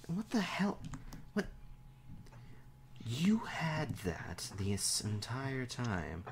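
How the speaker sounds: incredulous whisper; whispered disbelief. Acted. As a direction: gasp it out, stunned. NPC reacting in disbelief.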